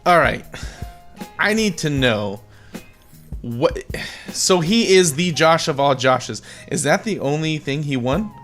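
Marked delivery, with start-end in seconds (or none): none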